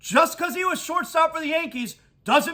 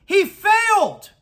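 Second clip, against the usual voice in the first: slow, loud and much higher; loud condemnation; 8 semitones higher, 5 dB louder, slower than usual. Real, not acted. Host condemning Jeter to his audience, loud and slow. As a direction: hammer the verdict home. Ranting about Jeter's job.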